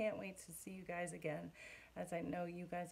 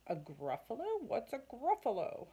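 fox startled, questioning louder; puzzled fox; 3 semitones higher, 3 dB louder than usual. Acted. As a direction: fox asking the mouse about the monster, surprised.

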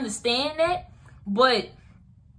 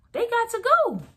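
"They got to go" jumped much higher, "go" falling; high emphatic; 12 semitones higher than usual. Real, not acted. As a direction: throw "go" up high, then let it fall. Repeated send-off, pitched way up.

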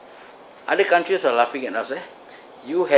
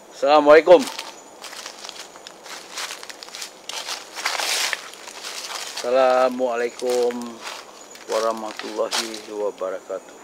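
calling out. Real, not acted. Explorer greets whoever is in the area, calling out. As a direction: call it out into open space.

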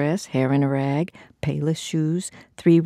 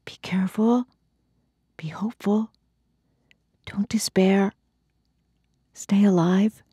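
murmuring heart; 5 semitones higher than usual. Acted. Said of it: "heart ... murmurs".

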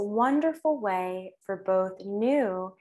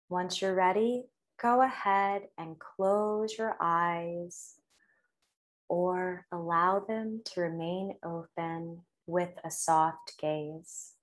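guided meditation. Real, not acted. Leads a breathing meditation.

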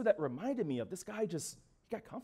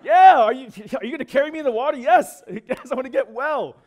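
loud, high, eager outburst; fired-up, emphatic yes; 6 semitones higher, 14 dB louder than usual. Acted. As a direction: jump on the offer with emphatic gusto. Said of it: "give him an emphatic".

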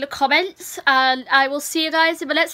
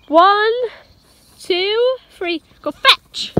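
fetch countdown; 7 semitones higher, 5 dB louder, much slower than usual. Real, not acted. Throwing for the dog.

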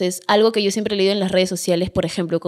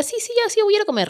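cheerful cover-up voice, pitched way up; sweet pretend compliance while hiding the food; faked eager agreement; 13 semitones higher, faster than usual. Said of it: "lo que yo hacía era".

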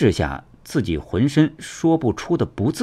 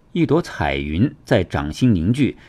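ordinary delivery, nothing marked

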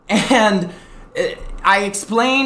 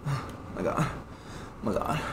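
very soft, winded oh my God; breathless exhaustion; 5 semitones lower, 16 dB quieter than usual. Real, not acted. Streamer worn out from his rant, catching his breath. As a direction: gasp it out, spent. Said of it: "making myself sweat".

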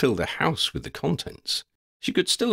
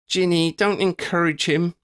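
playful warning; 10 semitones higher than usual. A narrator's reading. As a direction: scold her friend in fun. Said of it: "Lydia warned".

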